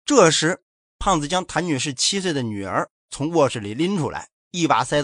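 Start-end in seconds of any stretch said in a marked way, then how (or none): none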